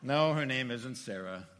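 loud joking retort. Retold or imitated. Joking reply about pregnancy.

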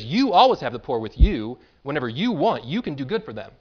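"you" stressed loudly, pointing it back at the critics. Shown as a demonstration. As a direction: hit the word "you" hard each time it comes. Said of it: "Notice all the times "you" shows up".

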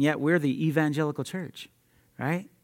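ordinary delivery

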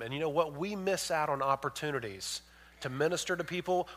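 ordinary talk, nothing marked

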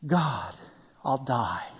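low, hammed-up despair; drama king. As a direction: play a dying drama king, pitched low. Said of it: "[name]'s maybe a drama king here".

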